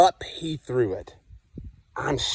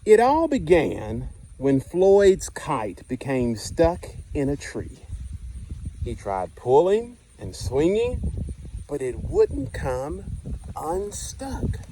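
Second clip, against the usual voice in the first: lively picture-book narration, dwelling on the punchlines; reading to children; 3 semitones higher than usual. Acted. Teacher reading Stuck to the class, storytime voice. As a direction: read it like storytime on the carpet.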